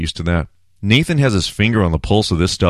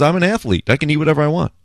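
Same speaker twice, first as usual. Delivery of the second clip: mock Dennis Rodman; 7 semitones higher, faster than usual. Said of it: "Rodman goes".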